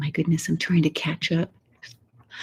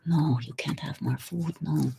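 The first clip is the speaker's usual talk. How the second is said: mock stern refusal to the cat; 2 semitones lower, 6 dB quieter than usual. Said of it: "And it's like".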